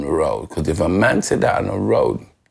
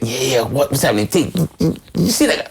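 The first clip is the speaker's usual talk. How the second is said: imitated dressing-room banter; 6 semitones higher than usual. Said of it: "If you get".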